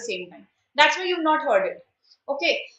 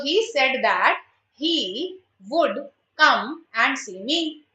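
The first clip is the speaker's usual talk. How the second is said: slow, word by word, as if dictating; drawn-out teacher read of the answer; slow dictation; much slower than usual. Shown as a demonstration. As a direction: spell the answer out slowly for the class. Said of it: "teacher dictating the correct answer".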